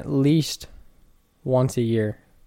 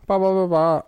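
air horn imitation; 5 semitones higher than usual. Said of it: "I want the one with the air horn".

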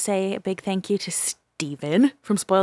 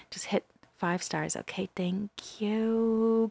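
soft unvoiced whisper, slow; 7 dB quieter, slower than usual. Host whispering while listeners rate the show, hushed. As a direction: hush it like a secret during the pause. Whispering during pause for rating.

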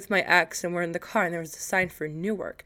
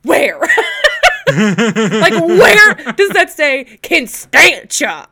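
loud, laughing reenactment of disbelief; incredulous outburst; 7 semitones higher, 11 dB louder, much slower than usual. Retold or imitated. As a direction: belt it out, stretching the words in mock outrage. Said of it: "I deadass went like this".

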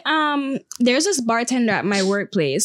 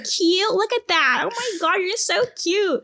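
very high, gushing mock voice; 8 semitones higher than usual. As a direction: coo in exaggerated amazement, drawing out the first words.

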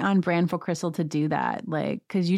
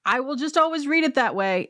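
amused confession; 9 semitones higher than usual. Real, not acted. Laughing at own misreading.

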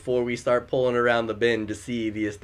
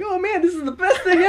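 high, loud, fast whoop of delight; retold excitement; 5 dB louder, faster than usual. Friend retelling his buddy's outburst while swimming, excited. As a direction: imitate an overexcited friend, pitch way up.

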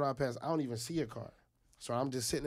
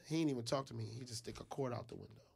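ordinary talk, nothing marked

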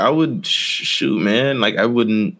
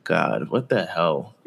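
low, slow, softer mock scoff; imitating the husband; 4 semitones lower, 6 dB quieter, slower than usual. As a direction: play the husband, dragged out low and quiet. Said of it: "he's like".

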